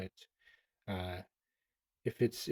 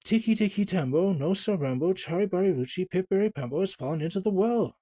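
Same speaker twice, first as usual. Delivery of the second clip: high, loud and breathless name rush; panting, out of breath; 8 semitones higher, 5 dB louder than usual. Acted. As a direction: gasp the long name out, winded from running. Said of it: "he panted".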